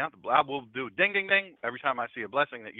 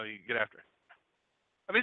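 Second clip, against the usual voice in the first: ordinary talk, nothing marked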